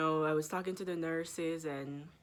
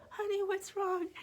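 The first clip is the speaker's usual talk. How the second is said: high, sweet nurse asking; lightly voiced caring nurse; 13 semitones higher than usual. Nurse gently asking the patient, high and sweet. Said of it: "The nurse was like".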